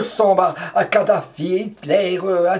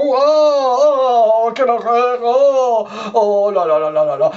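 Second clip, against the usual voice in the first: mock horror; 5 semitones higher, 5 dB louder than usual. Horrified at Trump in bed.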